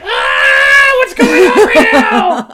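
high, slow, very loud panicked yelling; shouted freakout. Retold or imitated. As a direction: shriek it in mock alarm, dragging the words. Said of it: "And I'm like".

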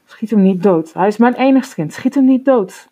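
mother wailing at the soldiers, loud and rising in pitch; wailing plea. A narrator's reading. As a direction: beg for your only child's life. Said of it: "En ze jammerde".

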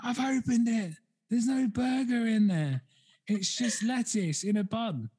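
high, slow indignant whine; mock indignant streamer. Streamer showing her live the empty bun, mock whiny. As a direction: complain slowly, up high, like she's been robbed. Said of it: "she was like".

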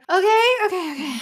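high, loud, flustered okays; frantic acceptance. Retold or imitated. Casting director hears it is another terminal, high frantic acknowledgement. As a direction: rattle them off in a high fluster.

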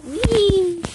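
high, loud drawn-out "wee"; playful wee. Acted. Hyper on sugar from sweets, playful squeal. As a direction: let out a hyper, high-pitched whee. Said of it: "I'm just like".

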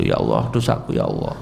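low, voiceless mock-remorseful plea; menirukan doa sedih. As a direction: imitate a sorrowful penitent, dropping to a husky near-whisper. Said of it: "terus kamu sedih gitu". (pura-pura sedih menyesali dosa dengan suara rendah)

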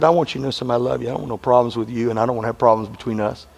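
low, gentle apology, almost whispered; a wife making up with her husband in the car, tender and hushed. Retold or imitated. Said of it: "she sits down and said".

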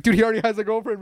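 high, quick teasing protest; mock friend protesting. Retelling a sketch, friend's quick high protest. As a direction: blurt it out fast and high. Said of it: "and he's like".

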